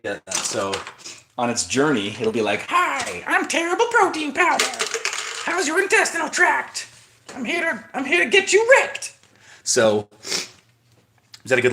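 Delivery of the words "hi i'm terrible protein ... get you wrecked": squeaky, much higher cartoon voice of a cheerful menace; talking protein powder. Protein powder greeting the viewer's intestines, in a very high cartoon voice. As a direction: play the protein powder as a gleeful little villain. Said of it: "that's kind of how my protein talks".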